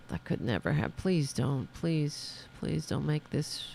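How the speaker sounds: hushed pleading. Real, not acted. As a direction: plead quietly, almost under your breath. Dreads him becoming president.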